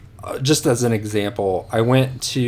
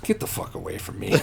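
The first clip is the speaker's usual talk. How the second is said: softer, quick, unvoiced mock dismissal with a laugh; hushed brush-off through laughter; 8 dB quieter, faster than usual. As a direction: toss it off under your breath, laughing. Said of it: "And he was like".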